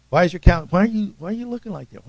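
high-pitched, rushed questioning; lightly acted, insistent demand; mock confronting. Acting out God questioning scowling Cain.

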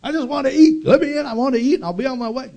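high, loud, exasperated truck driver yelling; mock-yell like a hungry trucker who's had enough. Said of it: "he's yelling at the woman".

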